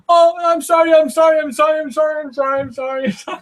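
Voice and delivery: high, wailing mock sobbing sorry; retold crying apology. Castaway crying to his friend after eel bite, high wailing sorry. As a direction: beg forgiveness, weeping high. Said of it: "he starts to cry".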